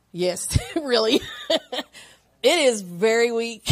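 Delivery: slow, laughing agreement; real amused laughter. Real, not acted. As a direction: laugh at how weak we are. Laughs at the verse.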